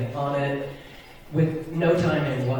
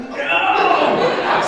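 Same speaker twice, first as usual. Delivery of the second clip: high, loud comic "no"; exaggerated cry of protest; mock dismayed cry; 12 semitones higher, 5 dB louder than usual. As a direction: wail it in hammed-up dread. Dismay as more kids raise hands.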